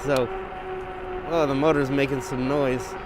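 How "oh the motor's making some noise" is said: ordinary talk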